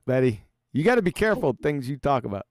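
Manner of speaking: ordinary talk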